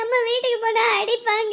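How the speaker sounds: put-on tearful, drawn-out child's complaint; crying. Child fears mother will hit them at home, crying. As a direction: sob the fear out in a whine. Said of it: "இப்ப எதுக்கு அழுவுற?".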